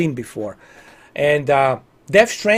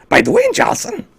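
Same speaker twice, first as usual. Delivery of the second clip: Yoda voice. Pretend. Streamer doing Yoda for viewers, squeaky and very high. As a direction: squeak like a tiny wrinkled alien. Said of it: "We gotta do this in a Yoda".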